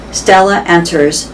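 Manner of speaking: plain reading voice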